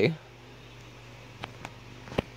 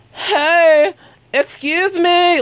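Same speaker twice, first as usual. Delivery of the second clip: put-on high, drawn-out drunken call; mock drunk; 10 semitones higher, much slower than usual. Drunk woman calling to the man she's filming, mock drunk slur. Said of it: "Like, drunk as all hell".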